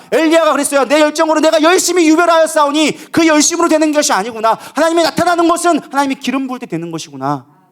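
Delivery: high, loud preacher's declamation; fervent preaching. Acted. Preacher voices Peter and Elijah realising it is not their zeal, fervent preaching.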